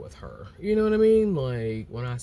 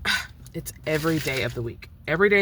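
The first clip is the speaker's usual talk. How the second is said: brisk, louder, bossy lady voice; mimicking the lady; 4 semitones higher, 6 dB louder than usual. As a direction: rattle it off fast like a nagging landlady. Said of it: "she's like".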